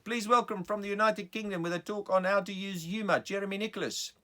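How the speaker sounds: loud, high South African announcer; South African accent. Acted. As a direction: introduce the speaker in a big South African accent. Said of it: "I already had a South African accent".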